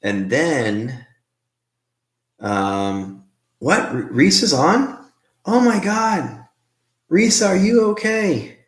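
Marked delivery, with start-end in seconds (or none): 3.6-8.6 high-pitched, astonished greeting turning to worry; real surprise, voice leaping up; surprised concern. Real, not acted. Host greets a viewer in hospital unexpectedly online, surprised concern.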